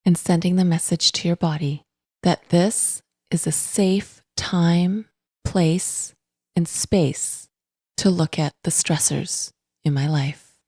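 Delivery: calm, unhurried meditation voice with long pauses; guided meditation. Acted. Podcast host guiding listeners to notice breath and body, calm meditation voice. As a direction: guide gently, in even, spacious phrases.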